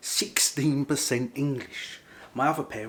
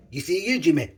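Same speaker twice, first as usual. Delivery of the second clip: raised, playful mock-Scottish call; Scottish lingo; 6 semitones higher, 9 dB louder than usual. Vlogger teases part-Scottish child, playful mock Scottish accent. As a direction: break into a put-on Scottish catchphrase. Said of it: "I learned some Scottish lingo".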